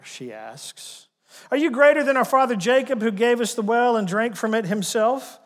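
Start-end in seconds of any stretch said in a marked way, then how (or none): 1.5-5.4 indignant challenge. Acted. Woman challenges Jesus at well.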